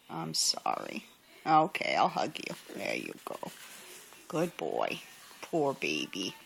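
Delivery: low, soft consoling pet talk; soothing. Real, not acted. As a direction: comfort a sulking bird gently. Consoling the sulking bird.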